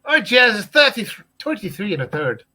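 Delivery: mock Irish accent, laughing. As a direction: ham up the Irish accent on the numbers. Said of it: "in the Irish accent".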